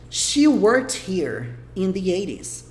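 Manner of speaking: very slow, deliberate reading; slow English demo. Shown as a demonstration. Teacher rereading the sentence slowly to students. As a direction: read it slowly so each word is clear. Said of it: "E o resto da frase?".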